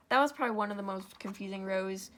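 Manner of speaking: ordinary delivery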